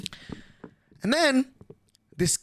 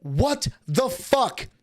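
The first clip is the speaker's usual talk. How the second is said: slow stunned; 5 semitones higher, 3 dB quieter, much slower than usual. Real, not acted. Shocked reaction.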